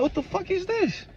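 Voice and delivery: startled high what-the-hell; shocked. Retold or imitated. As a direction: gasp it out in disbelief. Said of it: "I'm like".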